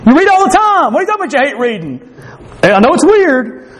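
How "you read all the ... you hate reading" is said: high, disbelieving coworker's voice; mock a coworker who can't believe it; incredulous coworker. A coworker scoffs at the reader who hates reading, high and incredulous. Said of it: "I have coworkers".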